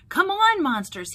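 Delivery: high, loud rallying call. A narrator's reading. Mike rallying monsters on the laugh floor, calling out. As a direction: call out to rouse the whole crew. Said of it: "he called".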